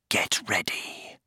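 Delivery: slow, excited whispered order; tense whisper. A narrator's reading. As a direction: whisper it slowly to waiting troops. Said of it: "he whispered".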